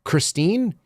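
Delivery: high, rising disbelief as dad; feigned surprise, pitched well up; mock astonishment. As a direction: act amazed at the name. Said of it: "he'll go".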